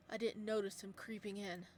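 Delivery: ordinary delivery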